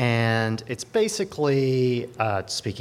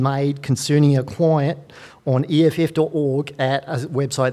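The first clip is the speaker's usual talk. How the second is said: hammed-up Australian lawyer voice, much higher and louder; mock Australian accent; 7 semitones higher, 6 dB louder than usual. Shown as a demonstration. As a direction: read it like an over-the-top Australian solicitor. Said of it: "I'll read it in my best Australian accent".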